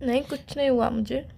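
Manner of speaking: soft, low, tearful denial; tearful, subdued. Real, not acted. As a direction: deny it quietly, as if hiding tears. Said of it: "रो सी क्यों रही हो?".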